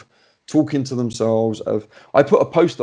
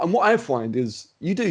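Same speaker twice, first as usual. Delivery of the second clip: ordinary talk, nothing marked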